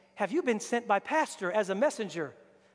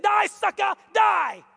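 much higher, louder hammed-up shout; mock furious yell; 9 semitones higher, 6 dB louder, slower than usual. Captive girl cursing Naaman, hammed-up yell. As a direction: snarl a cartoon curse. Captive girl's imagined revenge.

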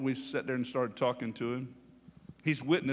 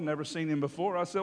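normal talking voice

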